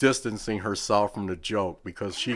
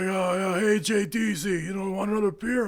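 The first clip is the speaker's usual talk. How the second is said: higher, hammed-up drunk buddy; buddy's voice, lightly done; 10 semitones higher than usual. Retelling the wrestler offering a beer, mock higher voice. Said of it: "He's like".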